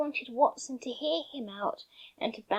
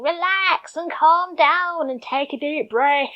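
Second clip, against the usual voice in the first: loud, pushy mock command; mock yell; 5 semitones higher, 9 dB louder than usual. Imitating someone yelling advice at a stressed friend, loud. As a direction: shout the advice like a frustrated friend. Quoting people who yell it.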